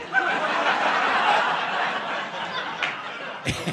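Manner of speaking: breathless high-pitched laugh; high wheezing laugh. Real, not acted. Comic cracking up at his own story, high wheezing laugh. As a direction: break into a wheezy giggle.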